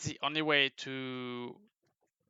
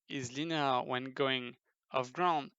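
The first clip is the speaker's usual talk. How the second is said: normal talking voice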